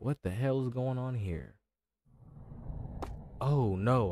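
ordinary talk

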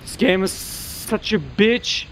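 normal talking voice

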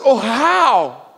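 high, loud, drawn-out plea; anguished questioning. Retold or imitated. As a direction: wail the question like a lost disciple. Thomas's plea acted out.

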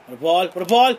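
loud cheer rising into a high yell; urgent shout. Real, not acted. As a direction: yell at your striker to act. Urging players on during live play.

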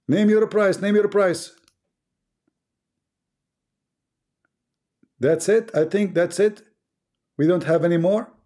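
put-on auction off his accent like a playful auctioneer; mock auctioneer.

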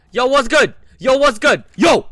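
high, loud, repeated holler; challenging shout. Real, not acted. Player confronts man at the door, challenging shout.

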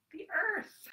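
excited reveal. Acted. Announcing favourite planet.

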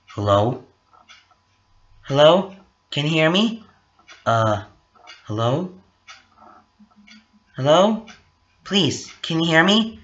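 rising, unanswered hello into a phone; pleading phone call. Acted. Cole phones a guy who stays silent, pleading. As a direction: keep checking whether anyone can hear you. Calling on phone, not heard.

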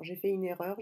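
ordinary talk

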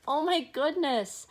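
usual speaking voice

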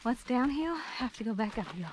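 normal talking voice

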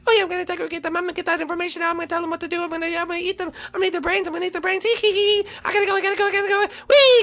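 very high, loud, manic squeal; ecstatic Lemmy. Acted. Lemmy greets his cousin in a high manic squeal. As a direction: bounce off the walls with giddy glee.

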